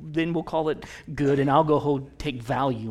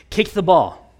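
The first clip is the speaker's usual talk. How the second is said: coach calling out; 3 semitones higher, 8 dB louder, slower than usual. Retold or imitated. Coach to a five-year-old during the game, calling out. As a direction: call it out to a young player.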